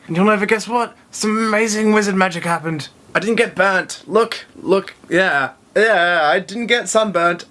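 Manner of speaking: high-pitched, marvelling delight; amazed excitement. Real, not acted. Amazed at escaping sunburn.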